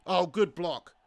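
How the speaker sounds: ordinary delivery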